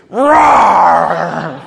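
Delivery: loud roar; dinosaur roar. Retold or imitated. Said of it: "she goes".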